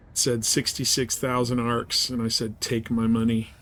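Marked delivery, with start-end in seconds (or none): none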